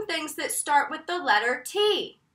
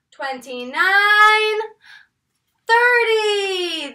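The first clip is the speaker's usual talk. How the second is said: counting out loud; 3 semitones higher, 4 dB louder, slower than usual. Shown as a demonstration. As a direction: count out big for the class. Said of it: "help me count".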